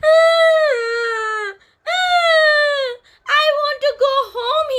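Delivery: high, loud sobbing little bird; high wailing birdie begging to go home; crying bird. Acted. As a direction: sob like a homesick baby bird.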